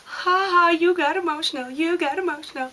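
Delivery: high, loud mocking singsong; teasing mock voice. Imitating Heather teasing her on the podcast, mock high voice. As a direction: tease her back in a shrill mock. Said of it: "she was teasing me on her podcast".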